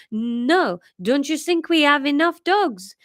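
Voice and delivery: put-on French-accented father teasing; Larry telling his son no in a French accent. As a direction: gently refuse in a French accent. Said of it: "now back to French".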